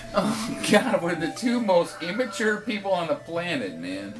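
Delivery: helpless, giggly speech; laughing hard. Real, not acted. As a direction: talk through real laughter. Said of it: "I'm laughing so hard".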